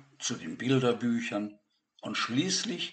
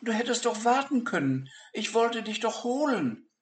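startled; 13 semitones higher, 4 dB louder than usual. Acted. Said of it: "rief Peter erschrocken".

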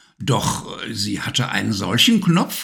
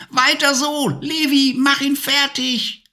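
high, cackling witch voice, delighted; shrieking witch. Acted. Witch cheering the returned fire lances, shrieking high. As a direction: screech it out like a gleeful old witch. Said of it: "kreischte die Hexe und beobachtete begeistert".

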